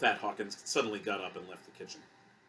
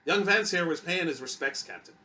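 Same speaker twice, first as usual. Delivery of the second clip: put-on Irish accent; 6 semitones higher, 6 dB louder than usual. A narrator's reading. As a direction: introduce yourself heartily with an Irish lilt. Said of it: "Edward retained an Irish accent".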